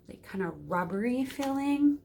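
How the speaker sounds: ordinary talk, nothing marked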